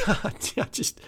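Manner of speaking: laughing in disbelief, breathy; a helpless laugh through the words; exasperated laugh. Real, not acted. Exasperated laugh mid-rant.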